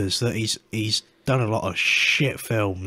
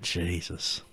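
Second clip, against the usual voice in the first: ordinary delivery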